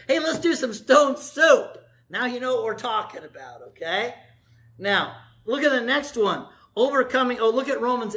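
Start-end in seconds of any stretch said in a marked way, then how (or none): 0.1-1.8 louder, slower, higher cheery call; cheery invitation. Retold or imitated. Preacher knocking on a neighbor's door with an invitation, cheery and loud. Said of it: "come knocking on your door and say".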